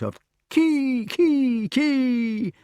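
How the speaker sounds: high piercing kee kee kee; kestrel calls. A narrator's reading. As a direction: shriek it like hawks calling. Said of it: "calling each other with their individual note".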